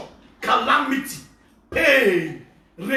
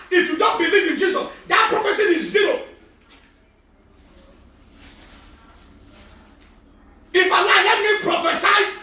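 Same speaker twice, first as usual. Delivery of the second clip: loud higher shouted preaching; preaching at full force; 8 semitones higher, 3 dB louder than usual. Acted. Pastor warning unbelievers, loud shouted preaching. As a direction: shout the prophecy out.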